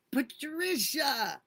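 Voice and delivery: high, loud, slow wail of a name; crying out a name. Real, not acted. Streamer overwhelmed to see her supporter, loud high cry.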